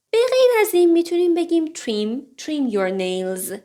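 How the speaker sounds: usual speaking voice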